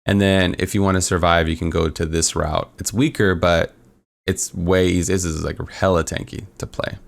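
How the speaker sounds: usual speaking voice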